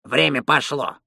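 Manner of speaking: put-on old attendant's voice from behind a wall; called through wall. A narrator's reading. Bath attendant starting the shower clock, calling through wall. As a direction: announce it from the next room. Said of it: "из-за стены сказал".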